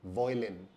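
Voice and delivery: वॉयलिन said voy-lin; वॉयलिन, voy not vai; wrong pronunciation. Shown as a demonstration.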